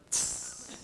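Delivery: short soft hissed "tss"; imitated angry tss. Wife reacting to husband's touch, imitated angry hiss. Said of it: "like that".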